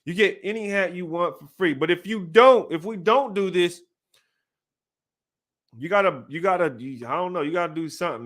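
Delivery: ordinary talk, nothing marked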